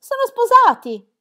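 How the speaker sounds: high, loud and slow, bursting with news; excited exclamation. A narrator's reading. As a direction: cry it out in disbelief and joy. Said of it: "esclamò Jane".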